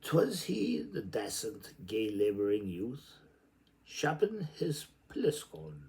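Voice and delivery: slow, lilting recital of Joyce; poetic reading. Acted. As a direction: read it like an incantation. Reads Joyce aloud.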